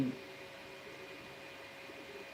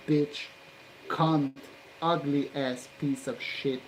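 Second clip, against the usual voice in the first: normal talking voice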